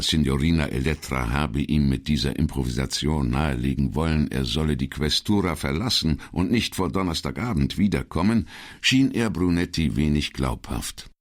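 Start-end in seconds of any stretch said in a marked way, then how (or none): none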